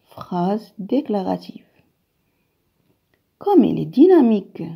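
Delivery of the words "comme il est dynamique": louder, lively exclamation. Shown as a demonstration. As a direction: make it sound amazed. Phrase exclamative. (ton exclamatif, plus fort)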